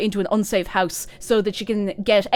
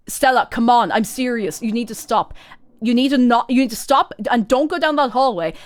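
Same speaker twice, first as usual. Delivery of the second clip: mock stern warning; 3 semitones higher, 7 dB louder than usual. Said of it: "And Tabitha's like".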